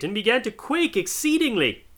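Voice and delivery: plain reading voice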